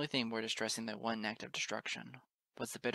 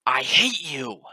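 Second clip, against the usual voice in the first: very loud, higher scream of hatred; furious scream; 4 semitones higher, 17 dB louder, much slower than usual. A narrator's reading. Narrator screaming into the chaos at the goddess, furious. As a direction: scream it out with everything. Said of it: "I screamed into the chaos".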